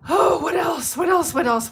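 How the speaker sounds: excited. Real, not acted. Listing offerings eagerly.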